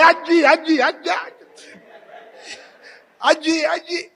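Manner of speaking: high, loud repeated calls; calling out. Retold or imitated. Husband re-enacting his calls at tawaf, calling out. Acts himself calling out in the crowd.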